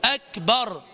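"أكبر" as "ak-bar" with light air on K; correct still K. Shown as a demonstration. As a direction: model أكبر for repetition, K vowelless. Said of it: "ردت خلفي".

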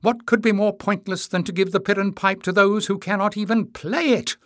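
very high, insistent rhetorical question; triumphant cry. A narrator's reading. Tyrrell rallying her people against the companions, triumphant cry. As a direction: drive the argument home, pitch soaring. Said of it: "Tyrrell cried".

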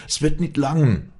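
loud, high call down the line. Acted. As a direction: shout it to the others at the rope. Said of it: "ruft der Letzte". (laut rufend)

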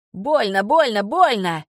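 pained, drawn-out whine; lightly voiced old man whimpering in pain. A narrator's reading. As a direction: moan it slowly, like it hurts. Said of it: "сначала пробормотал, а потом захныкал".

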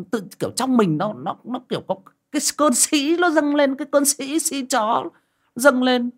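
high, giggling through the words; amused, laughing aside. Real, not acted. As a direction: laugh while you explain it. Laughing while describing lust.

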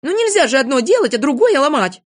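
loud, high-pitched arguing; heated protest. A narrator's reading. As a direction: argue back indignantly. Heated quarrel over the tent.

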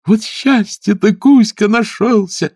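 high, slow, delighted mermaid. Acted. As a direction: gush with joy, high. (радостный голос русалки)

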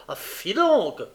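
high, scandalized exclamation; indignant disgust. Acted. Bumble shaming the young couple, indignant. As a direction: cry shame, pitch jumping up. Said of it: "au comble de l'indignation".

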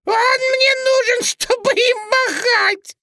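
high, loud tearful squeal; piercing plea, slowing at the end; desperate pleading. A narrator's reading. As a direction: wail it as the begging bunny. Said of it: "взмолился кролик".

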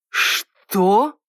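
loud, stunned gasp; shocked gasp. A narrator's reading. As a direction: breathe it out in shock. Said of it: "потрясённо выдохнул Бойд".

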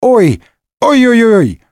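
high yelps of pain. A narrator's reading. Old lord pricked by a dagger, high pained yelping. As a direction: yelp as if jabbed from behind.

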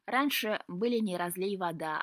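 ordinary delivery, nothing marked

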